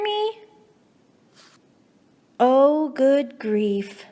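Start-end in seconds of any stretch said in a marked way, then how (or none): none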